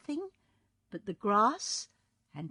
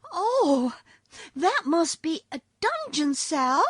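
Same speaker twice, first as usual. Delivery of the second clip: put-on higher, louder horrified girl; horrified cry; 11 semitones higher, 9 dB louder, slower than usual. A narrator's reading. Dorothy hearing of Ozma's prison, horrified. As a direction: gasp it out in horror. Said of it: "cried Dorothy, horrified".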